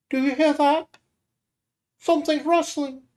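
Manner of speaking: high-pitched Nami, hushed and curious; high girl voice. Acted. Nami asks her friend about a rustling noise, high girl voice.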